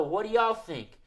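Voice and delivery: ordinary talk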